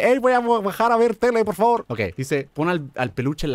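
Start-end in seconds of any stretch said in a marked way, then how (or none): 0.0-1.9 put-on higher voice of a polite kid asking permission; father voicing the baby he wants, asking to watch TV; mock child request. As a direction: play the well-behaved child knocking on the door. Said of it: "Golpéame la puerta y dime".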